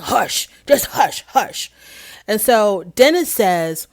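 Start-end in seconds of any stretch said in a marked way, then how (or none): 0.0-1.7 deep-voiced hush. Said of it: "there is some bass in his voice".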